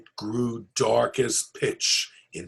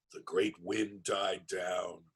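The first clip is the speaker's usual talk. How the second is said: plain reading voice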